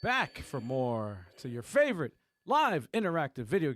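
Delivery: hyped show-host opening; announcer intro. Acted. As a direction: welcome them like a radio host. Podcast intro host hype.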